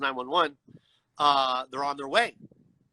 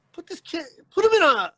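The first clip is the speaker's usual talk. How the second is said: retold outrage, jumping much higher; exasperated outburst; 11 semitones higher, 6 dB louder than usual. Objecting to firemen walking out a seizure patient, exasperated outburst. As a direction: start high, then burst out louder.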